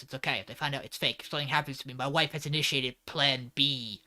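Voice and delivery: low, hushed thinking to himself; quiet, low inner voice; internal monologue. Retold or imitated. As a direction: voice a private thought softly. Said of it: "has this little internal monologue".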